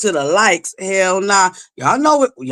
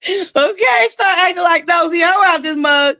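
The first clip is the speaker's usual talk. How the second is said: high, shouted threat; exasperated shout; 8 semitones higher than usual. Real, not acted. Streamer threatens lazy viewers, high shout. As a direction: yell it up high at the chat.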